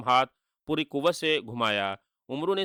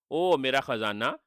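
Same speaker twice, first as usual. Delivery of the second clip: ordinary delivery, nothing marked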